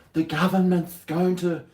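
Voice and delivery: high, slow, official announcer voice; mock news voice. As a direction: ham up an official warning. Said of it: "all those things".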